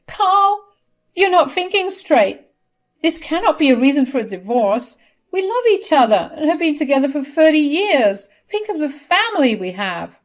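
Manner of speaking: high, loud begging wife; tearful plea. Acted. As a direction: beg your husband not to leave you. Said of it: "She cried and said".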